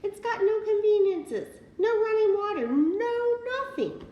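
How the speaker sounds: high, loud gruff farmer's protest; farmer crying out. Acted. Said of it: "he cried".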